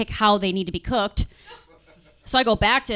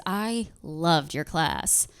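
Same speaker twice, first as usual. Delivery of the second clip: creepy compliment; 4 semitones lower, 6 dB quieter, much slower than usual. Retold or imitated. Creepy student's reply.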